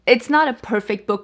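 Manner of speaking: ordinary talk, nothing marked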